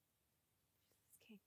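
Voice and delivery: slow, soft whispered thanks; whispered prayer in worship. Real, not acted. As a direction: breathe it to God.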